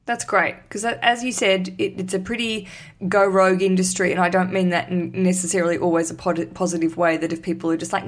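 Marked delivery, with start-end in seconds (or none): none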